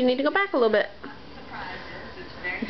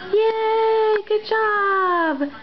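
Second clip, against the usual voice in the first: delighted praise; excited cheering; 5 semitones higher, much slower than usual. Real, not acted. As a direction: praise her to the skies. Cheering baby's success.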